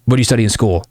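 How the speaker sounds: linear, uninflected questioning; boring interview mode. Shown as a demonstration. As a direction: run through questions like a dull interview. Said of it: "very linear and gross and just boring".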